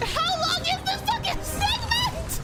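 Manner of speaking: high, frustrated shout; exasperated outburst. Real, not acted. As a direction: rant in exasperation.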